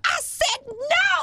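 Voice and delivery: high, slow mock yell at a kid; hammed-up mom yelling no; angry yell. Mom threatens her kid with a flip-flop, hammed-up yell. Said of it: "She's like".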